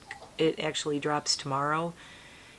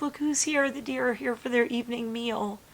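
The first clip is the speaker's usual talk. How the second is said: much higher, sing-song announcing; cutesy texting voice; 8 semitones higher than usual. Retold or imitated. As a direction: chirp it like greeting the deer. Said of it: "And I'm like".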